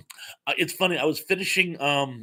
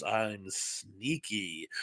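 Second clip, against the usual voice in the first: sneaky hush; 7 semitones lower, 12 dB quieter, much slower than usual. Pretend. Reads chatter's sneaky message.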